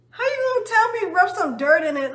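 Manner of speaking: high, loud, outraged disbelief; indignant retort. Retold or imitated. Daughter snapping back at mom's rub-dirt advice, loud and indignant. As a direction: throw her advice back at her, incredulous.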